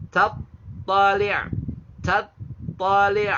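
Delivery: TATTALI' slow, high and loud, closing in the throat; stopping on ain. Shown as a demonstration. Teacher models for learners the pause form, ending on ain. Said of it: "itu kalau kita wakaf".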